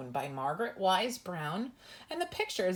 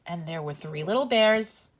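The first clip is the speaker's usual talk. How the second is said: gentle sing-song bedtime read-aloud; reading to children. Acted. Reads bedtime story to children.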